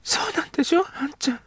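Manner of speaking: put-on a girl pleading, trailing off; young girl. Girl character speaks.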